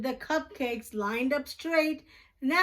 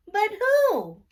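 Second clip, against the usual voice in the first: mystery question; 9 semitones higher, 6 dB louder than usual. A narrator's reading. Mystery refrain in kids story.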